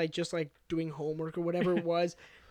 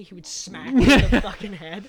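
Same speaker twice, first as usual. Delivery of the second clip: normal talking voice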